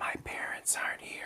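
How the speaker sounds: barely audible whisper, imitating a small kid; tiny hushed imitation of a child. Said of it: "you're still whispering to me".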